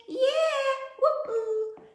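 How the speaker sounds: high whooping cheer; excited cheer. Real, not acted. Music teacher cheering on her class.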